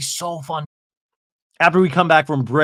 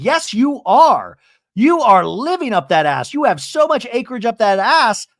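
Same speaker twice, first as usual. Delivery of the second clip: high, emphatic mock accusation; retold taunting retort; 7 semitones higher, 4 dB louder than usual. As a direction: hammer the comeback, stretching the opening. Acted quarrel retort.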